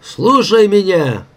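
high, drawn-out demand; commanding call. A narrator's reading. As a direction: call out for the crew's attention. Worked-up old welder demanding attention. (протяжный повелительный выкрик)

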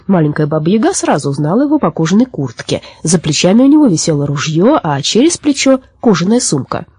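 usual speaking voice

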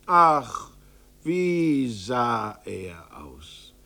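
slow, drawn-out lament; pitying sigh. A narrator's reading. Storyteller lamenting the aged father's look, slow. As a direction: mourn how old he looks. Narrator pities old father.

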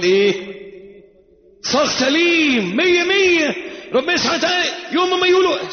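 high, emphatic sermon bursts; full-force preaching. Real, not acted. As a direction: hammer each point home.

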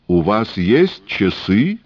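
put-on high, sing-song Yiddish-accented old man; wheedling old trader with Yiddish lilt.

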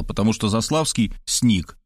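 ordinary delivery, nothing marked

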